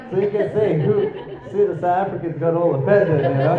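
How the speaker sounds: loud, high teasing through laughter; amused, laughing. Real, not acted. Speaker teasing South African audience over sports rivalry, laughing.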